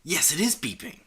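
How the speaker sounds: acted dawning realization; sudden realization. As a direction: confirm it with a lifted punch on IS. Reading Amber's realization aloud.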